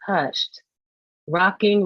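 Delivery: plain reading voice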